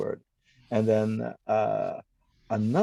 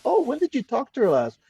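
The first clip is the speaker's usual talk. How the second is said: mock sweet question; 12 semitones higher than usual. Retold chat with scammer.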